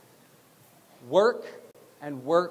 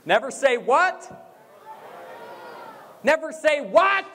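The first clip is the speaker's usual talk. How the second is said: raised, rising question to a crowd; call-and-response prompt; 7 semitones higher, slower than usual. Real, not acted. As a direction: fire up the audience with a ringing question. Prompting audience to shout back.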